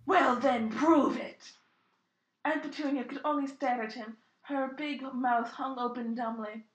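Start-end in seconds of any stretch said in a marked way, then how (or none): 0.1-1.5 loud, firm dare; loud challenge. Acted. Hagrid challenging Aunt Petunia, loud firm dare.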